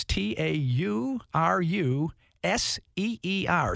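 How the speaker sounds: slow, letter by letter spelling; spelled out. Shown as a demonstration. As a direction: spell T A U R U S E E R slowly, one letter at a time. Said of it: "I'm gonna spell that".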